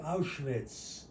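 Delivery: usual speaking voice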